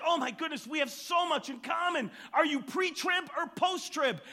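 high, loud and gushing; beside himself with joy at the match; overjoyed preacher. Retold or imitated. Preacher thrilled with the man's answers, high and loud. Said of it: "And the pastor said".

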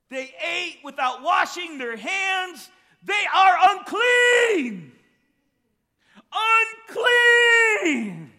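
hammed-up Pharisee shrieking unclean; outraged Pharisee. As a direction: scream the accusation in horror. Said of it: "the Pharisees are like".

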